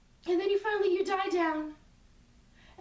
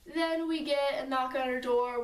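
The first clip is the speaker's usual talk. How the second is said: ordinary delivery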